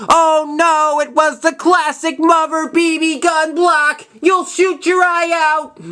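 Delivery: high, slow, hammed-up dismay; high, stretched mock horror at mom's verdict. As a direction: wail it like a kid whose dream just died. Adult Ralphie narration, dismayed.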